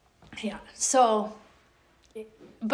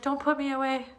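high, laughing, sweet-talking plea; pleading dog voice; 6 semitones higher, 7 dB louder than usual. Retold or imitated. Dog pleading not to be crated, high laughing plea. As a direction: beg like a dog avoiding the crate. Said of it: "be like".

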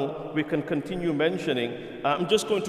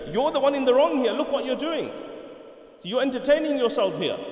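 indignant retort; 7 semitones higher than usual. Retold or imitated. Retold caliph's retort in the argument.

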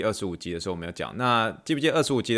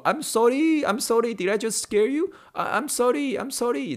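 much higher, anxious flurry of sorrys; apologetic Indian patient; 13 semitones higher, slower than usual. Retold or imitated. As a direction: imitate a worried patient apologizing. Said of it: "他们就说".

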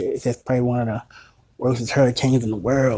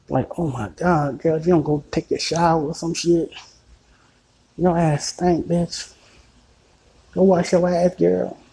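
scolding a woman; 7 semitones higher than usual. Retold or imitated. Mock scolding a date who needs a shower. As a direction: chew her out in a shrill-voiced put-on.

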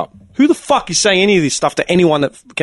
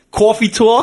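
coffee talk in a put-on New York accent; a higher, put-on East Coast American accent; Boston-New York accent; 6 semitones higher, slower than usual. Podcaster naming the segment, Boston-New York accent. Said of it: "Is that the Boston accent?".

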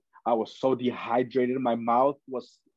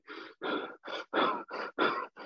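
long exhausted sigh; 7 dB quieter than usual. Retold or imitated. Finally reaching the door.

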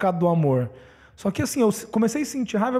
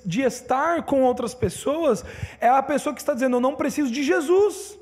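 impassioned preaching, higher pitch; pregação inflamada; 4 semitones higher than usual. Acted. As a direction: exhort the congregation with rising conviction. (pregação enfática, voz mais aguda)

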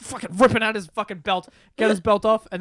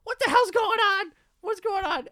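mock alarmed mom; 11 semitones higher than usual. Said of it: "She's like".

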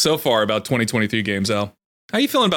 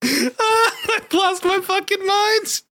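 very high, drawn-out laughing shriek; manic screaming; slower than usual. Pretend. Streamer confessing lore obsession to co-host, manic screaming. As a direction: wail it as if playfully unhinged. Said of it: "I'm sorry for screaming into the mic".

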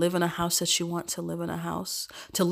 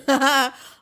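loud laugh; 8 semitones higher, 9 dB louder than usual. Real, not acted. Laughs about sounding bad.

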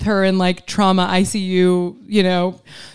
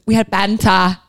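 mock British accent. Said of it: "I think I do a good British accent".

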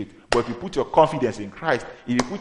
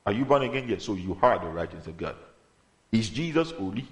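ordinary talk, nothing marked

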